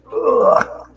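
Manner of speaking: short, loud grunt of effort; effortful groan. Real, not acted. Streamer fixing the camera in a corner, loud groan. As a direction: groan out loud while straining to reach.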